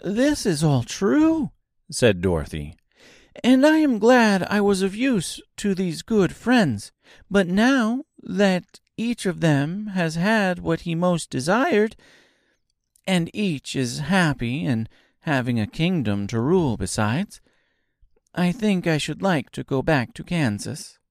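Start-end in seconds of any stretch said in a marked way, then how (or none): 0.0-1.5 put-on high, eager little girl; sweet child asking earnestly; young girl voice. A narrator's reading. Dorothy speaking. 3.4-21.0 put-on high, eager little girl; sweet child asking earnestly; young girl voice. A narrator's reading. Dorothy speaking.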